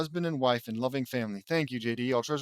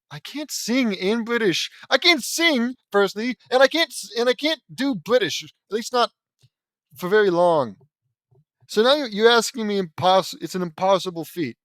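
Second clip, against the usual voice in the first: high, loud protest; exasperated complaining; 6 semitones higher, 6 dB louder than usual. Real, not acted. Reader protests that they can't sing in British, loud exasperation. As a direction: complain hard, voice pitched up. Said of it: "half complaining about it".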